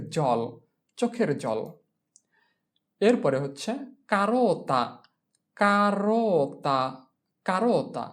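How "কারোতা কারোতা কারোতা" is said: কারোতা drawn out, ka-RO-ta; slow model কারোতা; slow teaching pronunciation. Shown as a demonstration. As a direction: stretch কারোতা for learners. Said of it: "teacher models Italian word".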